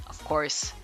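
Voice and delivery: ordinary talk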